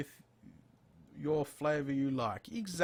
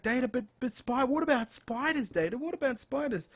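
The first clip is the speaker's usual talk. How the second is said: much higher, pestering voice; mocking eager viewers; 10 semitones higher than usual. Mimicking viewers who keep asking about spiders, high and pestering. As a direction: imitate fans nagging him over and over. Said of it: "because people always like".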